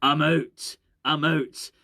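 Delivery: gruff Scottish impression; Scottish accent. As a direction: say it curtly as a gruff Scot. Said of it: "gruff Scottish guy".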